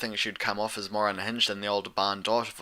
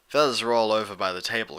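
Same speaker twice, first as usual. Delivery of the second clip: ordinary delivery, nothing marked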